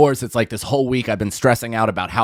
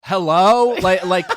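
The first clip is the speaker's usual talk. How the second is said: raised, louder impatient hello; impatient caller; 6 semitones higher, 4 dB louder, slower than usual. Retold or imitated. Caller to a silent clerk after thirty seconds, impatient. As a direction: call loudly into a silent phone, acted out.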